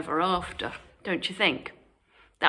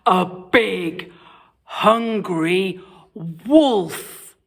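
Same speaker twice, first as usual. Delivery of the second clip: louder, slow ominous build; storyteller unveiling the big bad wolf, loud and slow; dramatic reveal; 7 dB louder, much slower than usual. A narrator's reading. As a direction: drag it out to scare.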